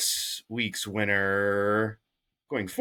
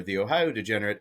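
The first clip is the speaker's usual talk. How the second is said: normal talking voice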